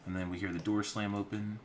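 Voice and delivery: usual speaking voice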